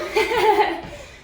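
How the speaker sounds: bright burst of real laughter; a quick genuine laugh at herself. Real, not acted. Trainer laughing at her own slip, real laugh.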